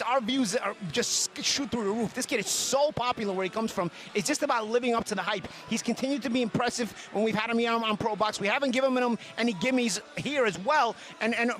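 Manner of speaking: normal talking voice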